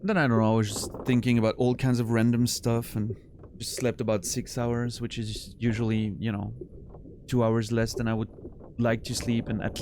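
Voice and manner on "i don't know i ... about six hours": ordinary talk, nothing marked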